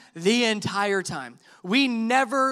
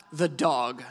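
low, quieter deadpan drop; deadpan reveal; 9 semitones lower, 3 dB quieter than usual. Retold or imitated. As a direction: let it land low and dry after a pause.

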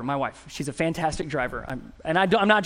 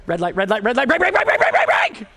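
shrieks brake over and over, climbing sharply higher and louder; the nervous husband in the passenger seat panicking at a red light, yelling brake higher and higher; panicked passenger yelling; 6 semitones higher, 6 dB louder than usual. Retold or imitated.

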